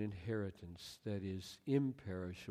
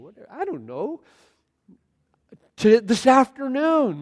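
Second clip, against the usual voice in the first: high, loud, incredulous preaching; exasperated self-rebuke; 12 semitones higher, 16 dB louder than usual. Acted. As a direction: talk sense into yourself, building to a shout. Preacher scolds himself in exasperation.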